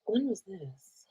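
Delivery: breathy whispered question; slow hushed whisper so nobody hears. Real, not acted. Quietly asking someone off camera, whispered.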